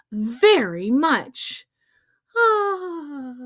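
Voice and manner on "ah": ordinary delivery, nothing marked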